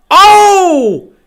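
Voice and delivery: high, loud startled "Oh!"; surprised shout. Real, not acted. Fan shouting as the pass gets tipped. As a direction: yelp at the sudden tip.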